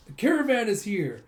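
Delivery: high, loud announcement; calling out. Retold or imitated. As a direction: shout the news to everyone. Kid announcing the caravan.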